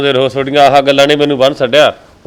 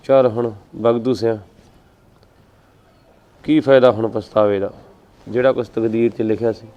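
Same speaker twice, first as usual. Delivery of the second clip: much lower, soft, heavy-hearted sigh of regret; sad lament; 5 semitones lower, 5 dB quieter than usual. Acted. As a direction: brood over a wasted life quietly. Same lament, resignation.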